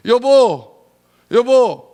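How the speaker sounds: loud, high calling out 여보; wife calls to her husband, loud and high. Acted. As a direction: call your rich husband across the house. Preacher plays wife calling husband.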